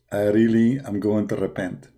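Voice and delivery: ordinary delivery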